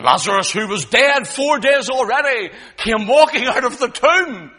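loud, high, building oratory; full-force preaching. Real, not acted. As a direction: hammer out each phrase from the pulpit.

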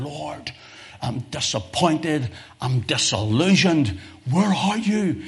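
a pleading cry to God, rising high at the end; disillusioned prayer. Acted. Hurting believer asks God where he is, pleading. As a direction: voice a hurt believer calling out, where are you.

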